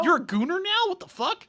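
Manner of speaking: high-pitched, retold disbelief; astonished mock questioning; incredulous. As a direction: act out a baffled brother, pitch jumping up. Retold brother's astonished reaction to goons.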